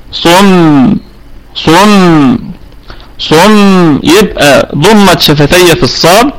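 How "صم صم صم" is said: صم with the lips rounded on the ص, then spread on the ميم with a held nasal hum; lips closed then opened. Shown as a demonstration. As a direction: demonstrate صم slowly, the lips pressed shut and then opening into a light smile. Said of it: "يبقى نقول". (نطق صم بضم الشفتين في الصاد ثم بسطهما في الميم مع غنة)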